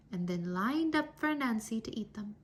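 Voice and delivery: usual speaking voice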